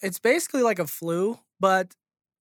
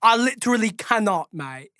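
mock British accent. Keeping the joke going, mock British accent. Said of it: "you know, British accent".

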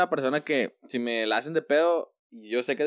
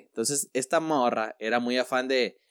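ordinary talk

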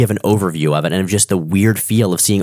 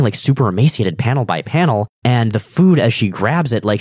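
ordinary talk, nothing marked

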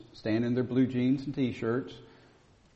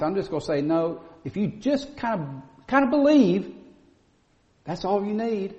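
lampoon the preacher who waters it down; rising to a squeaky peak on "kind of believe"; mocking a watered-down preacher; 6 semitones higher, 5 dB louder than usual. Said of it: "They'll say".

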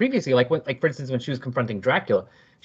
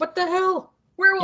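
high, shrill-free startled yell; panicked shout. Acted. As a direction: yelp it in sudden alarm. Cops panicking at werewolf.

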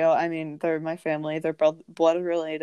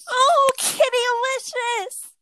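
very high, loud, drawn-out squeal; gushing aunt impression; 5 dB louder, slower than usual. Aunt greeting the cat as it walks in, high gushing squeal. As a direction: mimic an aunt squealing slowly over a cat.

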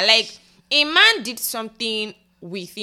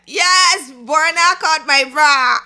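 excited; 6 semitones higher, 5 dB louder, much slower than usual. Retold or imitated. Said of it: "to put out a tweet".

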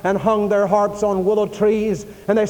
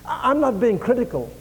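usual speaking voice

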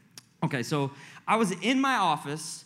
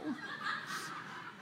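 breathy giggle; 9 semitones higher, 13 dB quieter than usual. Real, not acted. Laughing at own reaction.

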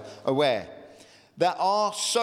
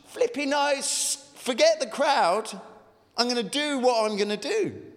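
defiant beggar; 7 semitones higher than usual. Retold or imitated.

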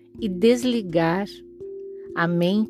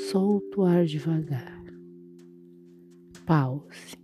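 soft spa whisper; 4 dB quieter, slower than usual. Acted. Guided breathing meditation.

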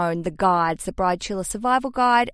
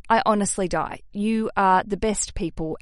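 ordinary talk

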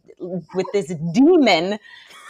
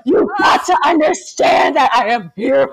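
loud, forced-sweet shouting, higher; sweet demon voice; 6 semitones higher, 6 dB louder, slower than usual. Retold or imitated. Mom reenacting shaking her son, loud sweet demon voice. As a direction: yell kindness through clenched intensity. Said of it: "full demon voice, but, like, as sweet as I could".

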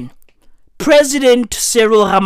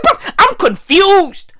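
high-pitched, drawn-out mock confusion; retold bewilderment; 6 semitones higher, slower than usual. Recalling his reaction to the president's welcome, bewildered. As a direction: act out baffled disbelief, slowly.

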